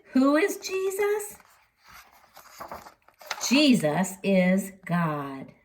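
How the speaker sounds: ordinary delivery, nothing marked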